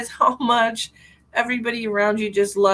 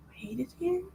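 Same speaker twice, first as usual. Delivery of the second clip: soft, higher, rising wounded question; mock hurt question; 5 semitones higher, 9 dB quieter than usual. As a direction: act out being quietly hurt. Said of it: "My feelings were hurt".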